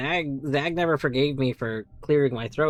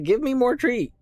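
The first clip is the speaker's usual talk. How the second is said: higher, demanding cat voice; voicing a cat; 9 semitones higher, 3 dB louder than usual. The cat Oliver begging for treats, pushy. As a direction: pester for more treat. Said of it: "Oliver's over here like".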